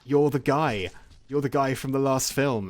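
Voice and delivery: gushing, starstruck fangirl imitation; excited fangirl. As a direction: play a fan spotting their hero. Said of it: "this fangirl turns up and is like".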